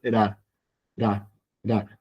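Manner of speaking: "ra" as a single tap close to an L; R leaning toward L. Shown as a demonstration. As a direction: flick each low "ra" lightly, without a trill. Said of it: "Como tirando a L".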